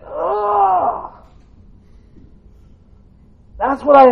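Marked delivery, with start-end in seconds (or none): 0.0-1.1 high groan of someone dragged out of bed; sleepy high-pitched ugh; groggy morning groan. Retold or imitated. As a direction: groan like you were just woken. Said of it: "you know, you get that".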